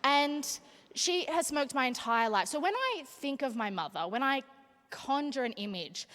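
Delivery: ordinary delivery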